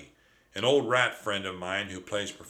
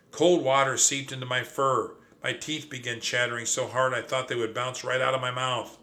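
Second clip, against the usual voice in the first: ordinary delivery, nothing marked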